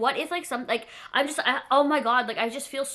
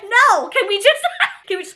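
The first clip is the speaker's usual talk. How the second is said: anxious plea; 11 semitones higher, 11 dB louder than usual. Retold or imitated. Panicked plea to wait.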